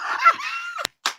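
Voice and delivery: shrieking high laugh; a high squealing cackle; high delighted cackle. Real, not acted. Laughs after shout-out.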